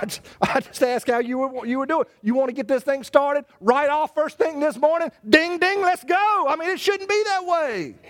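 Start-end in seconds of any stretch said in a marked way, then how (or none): 5.3-6.5 higher, hyped ring announcer call; fight bell call. Acted. Preacher mocking a couple squaring up like a boxing match. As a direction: ring the bell for round one.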